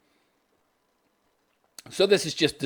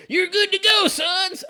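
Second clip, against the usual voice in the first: mock king father; slower than usual. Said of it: "says their daddy".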